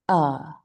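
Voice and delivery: "uh" as a relaxed, neutral vowel; schwa sound. Shown as a demonstration. Teacher showing the second sound of A, schwa sound. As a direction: say "uh" from the throat with a loose jaw. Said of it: "Your jaw is relaxed".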